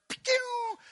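high-pitched "pew" whoosh; zooming-away noise. Acted. Bad shepherd fleeing trouble in the church, zooming noise. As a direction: imitate something shooting off fast.

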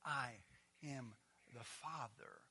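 Jesus correcting. Retold or imitated. Jesus correcting Philip, slow and hushed. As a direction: hush it down and correct him gently. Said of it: "Jesus immediately corrects him and says".

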